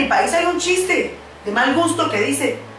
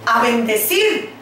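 usual speaking voice

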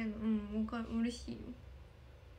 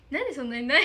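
much louder, exasperated outburst; loud exclamation; 13 dB louder, faster than usual. Real, not acted. Streamer complaining the snack is always sold out, loud outcry. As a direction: blurt it out in disbelief.

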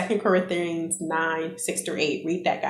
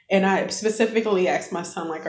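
ordinary talk

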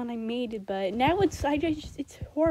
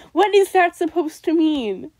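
louder disbelieving question, hit the word is; loud baffled outburst; 5 semitones higher, 11 dB louder than usual. Real, not acted. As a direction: shout it out in disbelief. Baffled by own lyric.

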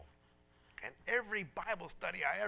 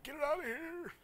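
high-pitched panicky squeal, hammed up; mock terrified; 10 semitones higher than usual. Big prayer warrior fleeing an old babushka, mock terrified. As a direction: imitate yourself scared out of your wits. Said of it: "I was just terrified".

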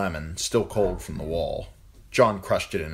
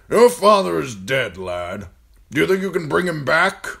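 put-on raised, loud commanding old man; gruff old lord commander; 11 semitones higher, 10 dB louder than usual. A narrator's reading. Old commander ordering John to serve food, loud and gruff. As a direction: bark orders at the boy like an old soldier. Mormont voiced in story.

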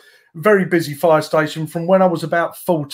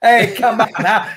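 high-pitched mock New York accent; playful put-on American accent, laughing; 7 semitones higher, 3 dB louder than usual. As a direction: toss it out as a New Yorker. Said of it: "that's not bad".

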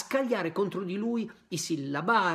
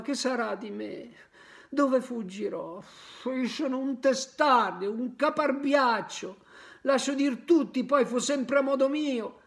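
higher, louder, desperate lament; desperate crying; 6 semitones higher, 4 dB louder than usual. Acted. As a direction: plead with the fallen friend, then panic. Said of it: "E piangeva e si disperava".

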